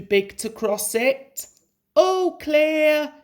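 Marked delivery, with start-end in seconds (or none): 2.0-3.1 high bright call ahead to a friend. A narrator's reading. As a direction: call out to Duck up the line. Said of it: "he called to Duck".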